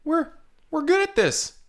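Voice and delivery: high, loud self-congratulating; smug excited cheer. Acted. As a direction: cheer yourselves on smugly. Managers congratulate themselves.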